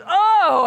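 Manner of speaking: loud, high "oh!" of shock; sudden acted cry after a knock; cry of pain. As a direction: re-enact a pained yell, pitched well up. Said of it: "I let out a cry".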